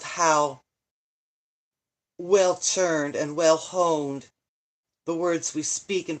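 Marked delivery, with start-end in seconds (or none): 2.2-4.3 drawn out, each word weighted; slow emphasis. Real, not acted. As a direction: spell it out slowly for effect.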